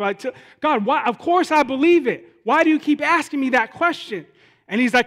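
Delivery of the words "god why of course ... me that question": higher, louder exasperated pleading; exasperated protest. Retold or imitated. Believer to God over a repeated question, exasperated. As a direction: protest as if asked once too often.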